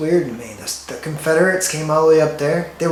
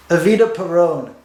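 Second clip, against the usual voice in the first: ordinary delivery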